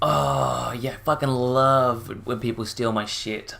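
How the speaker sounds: slow, drawn-out sarcasm; sarcastic mock approval. Commentator mocking a phone-stealing prank, slow sarcastic praise. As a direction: drag out the fake enthusiasm.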